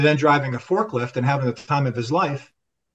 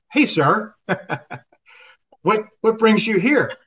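higher, bright polite greeting with a nervous laugh; cheery awkward greeting; 6 semitones higher than usual. Retold or imitated. Greeting his old boss's boss with a covering laugh, chirpy. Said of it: "What I said was".

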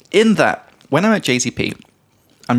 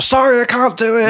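high, held-back mock yell; mock scream; 11 semitones higher than usual. Customer refusing from fifty meters away, mock yell. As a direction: pretend to shout an excuse across the street. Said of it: "I don't wanna scream in my explanation".

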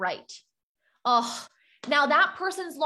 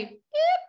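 tiny high yelp, much higher; squeaky eep. Shown as a demonstration. Coach showing how the brain flinches, a high squeak. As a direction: squeak it out like a startled mouse. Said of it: "Can you say".

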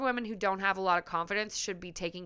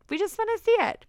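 much higher and louder, squealing it out; squealy eager voyeur; 12 semitones higher, 7 dB louder, faster than usual. Pretend. Host playfully voicing eager voyeurs to listeners, squealing high. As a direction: pitch it way up like an eager peeker.

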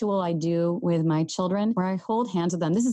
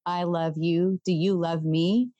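slow squeeze pattern; slower than usual. Retold or imitated. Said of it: "squeeze out the pattern for".

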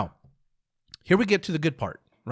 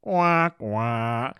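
sad-trombone "wah, wah"; falling two-note letdown buzzer; mock disappointment noise; 3 semitones lower than usual. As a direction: imitate a deflating game-show fail sound. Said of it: "people would have been like".

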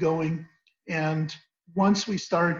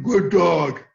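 loud, gruff "good dog"; the wrong way, low and stern; 6 dB louder than usual. Shown as a demonstration. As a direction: praise the dog in a low, forceful voice. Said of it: "low voices are dogs that".

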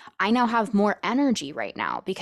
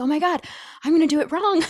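high, louder, hammed-up nervous panic; playful retold anxiety, pitched up and louder; mock panic; 10 semitones higher, 5 dB louder, much faster than usual. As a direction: squeal it like you're about to mess up. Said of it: "a lot of nervous energy".

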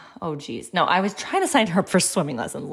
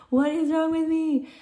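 a lightly hammed-up despairing wail, pitched way up; high mock wail; 10 semitones higher than usual. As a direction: repeat it much higher, in a thin mock wail. Repeated question, voice thrown up.

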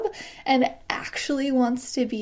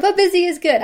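upbeat mantra; 7 semitones higher, 7 dB louder, faster than usual. Retold or imitated. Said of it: "I literally say that after every single time".

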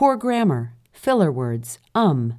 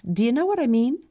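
upspeak; 8 semitones higher, faster than usual. Shown as a demonstration. Illustrating lilting upspeak.

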